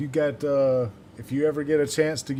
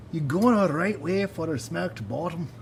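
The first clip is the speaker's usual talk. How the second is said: attempted Scottish accent, higher-pitched; 4 semitones higher than usual. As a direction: have a rough go at sounding Scottish.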